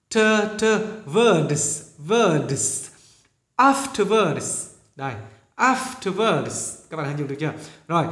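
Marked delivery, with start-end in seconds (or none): 0.1-6.7 Afterwards spelled out slowly. Shown as a demonstration. Teacher slowly modelling afterwards for class.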